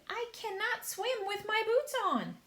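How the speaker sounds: high, loud centipede voice; panicky centipede. A narrator's reading. Centipede panicking about swimming in boots. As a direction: blurt it in alarm.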